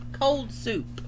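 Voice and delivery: plain reading voice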